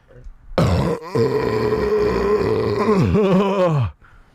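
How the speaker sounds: long hacking coughing fit. Real, not acted. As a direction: cough hard after a hit.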